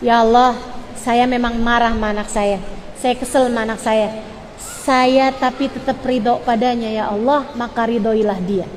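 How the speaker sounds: low, softened plea to God; model parent's prayer. Shown as a demonstration. Mother praying over a child she is angry with, low and subdued. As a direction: confess the anger quietly, then plead. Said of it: "doanya kayak gini nih".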